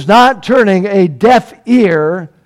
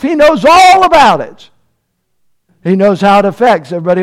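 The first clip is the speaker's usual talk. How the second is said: rising, louder preaching; building sermon; 5 semitones higher, 3 dB louder than usual. Acted. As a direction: drive it home with mounting fervor.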